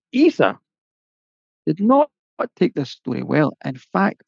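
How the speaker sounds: plain reading voice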